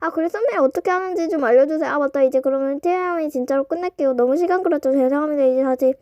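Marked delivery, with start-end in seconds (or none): none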